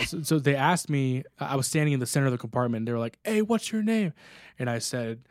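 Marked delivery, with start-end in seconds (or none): 3.2-4.1 a mild retold call of hey, pitched up; casual crew question. Shipmates in the compartment asking his name, breezy call. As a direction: sailors asking the new guy his name, tossed off lightly.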